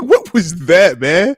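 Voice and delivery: usual speaking voice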